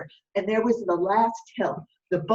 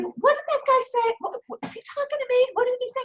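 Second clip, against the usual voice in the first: very high, puzzled captain; captain straining to hear; captain's voice; much faster than usual. Acted. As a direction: squeak it out like a confused old skipper. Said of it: "And he said".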